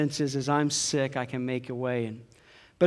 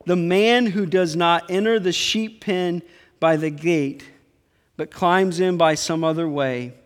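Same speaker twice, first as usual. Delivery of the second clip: louder, proclaiming; forceful scripture reading; 3 semitones higher, 6 dB louder than usual. Acted. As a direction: read Jesus' warning to the church.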